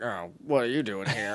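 low, soft grumpy grumbling; super angry grump. Retold or imitated. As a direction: imitate a cross grump under your breath. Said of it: "He's, like, super angry".

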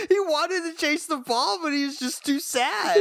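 high-pitched pitying wail; hammed-up sorrowful protest; mock heartbreak. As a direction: lament the poor dog with comic anguish. Mock pity for the dog.

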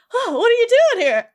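high, loud acted outburst; frantic Sora. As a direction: burst out as if losing your mind. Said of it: "just properly just loses his mind".

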